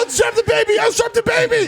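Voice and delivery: very high, frantic mock scream; screaming in panic. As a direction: squeal an urgent order like a panicked parent. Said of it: "everyone in the car is screaming".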